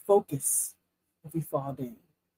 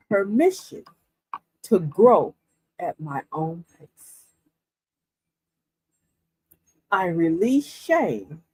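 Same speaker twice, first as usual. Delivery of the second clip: slow, measured affirmation read with long pauses; slower than usual. Acted. Reading an affirmation to viewers, slow and deliberate. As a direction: lay each word down slowly, like a vow. Reads affirmation aloud slowly.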